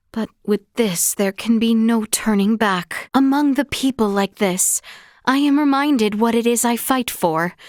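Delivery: ordinary talk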